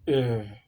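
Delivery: a low easy A; plain A. Shown as a demonstration. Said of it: "Syllabic stress".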